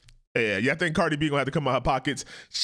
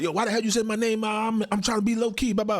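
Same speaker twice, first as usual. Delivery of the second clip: 1 mock [name] snapping; 8 semitones higher than usual. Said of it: "if she turn around like".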